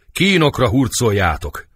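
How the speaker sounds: high, loud command; dramatic wrathful shout; fierce order. Acted. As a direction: order the prisoner dragged away. Rebel orders prisoner to torture.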